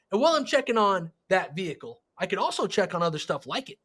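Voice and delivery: usual speaking voice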